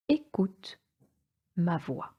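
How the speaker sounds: ordinary talk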